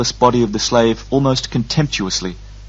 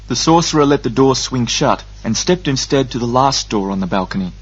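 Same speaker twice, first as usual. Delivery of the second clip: ordinary delivery, nothing marked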